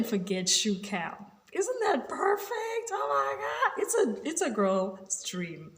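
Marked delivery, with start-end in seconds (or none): 1.5-5.7 high, slow squeal of delight; gushing. Real, not acted. Girly fan raving about Shoe Cam, excited squeal. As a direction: swoon over the shoes, way up high.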